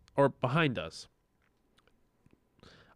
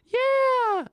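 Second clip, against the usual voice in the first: very high, drawn-out excited yell; imitated girls' cheer; 4 dB louder than usual. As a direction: mimic a group of girls calling back. Girls answer his call from below.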